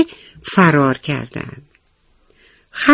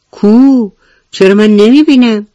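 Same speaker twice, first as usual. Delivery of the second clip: louder, higher incredulous question; puzzled lion; 6 semitones higher, 6 dB louder than usual. Acted. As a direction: demand to see it, baffled. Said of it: "پرسید". (شیر متعجب و بلند)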